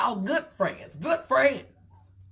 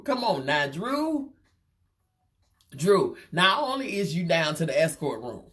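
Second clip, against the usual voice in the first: usual speaking voice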